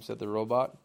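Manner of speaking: ordinary delivery, nothing marked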